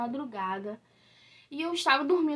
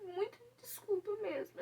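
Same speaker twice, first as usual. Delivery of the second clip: apologising to viewers while crying; tearful apology; 6 semitones higher, 8 dB quieter than usual. Real, not acted. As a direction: apologise through tears. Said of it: "Eu não queria chorar nesse vídeo".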